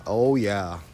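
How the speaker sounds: ordinary talk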